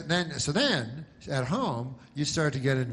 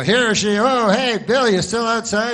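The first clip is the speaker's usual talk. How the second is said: loud, bright hammed-up TV anchor calling out; hammed-up anchor calling to a reporter outside; mock news anchor, loud; 8 semitones higher, 7 dB louder than usual. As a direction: shout it like a newscaster tossing to the field reporter. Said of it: "They go".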